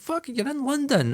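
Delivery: high, drawn-out objection; mock whiny protest. Acts out his protest to producer.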